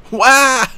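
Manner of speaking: high, loud cartoon wail; a silly burst of fury; playful cry of rage; mock outraged yell. Mock outburst repeated.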